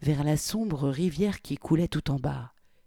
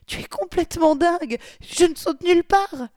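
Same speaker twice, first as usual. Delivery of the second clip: panicked protest; 7 dB louder than usual. Acted. Victoria protesting being made to jump.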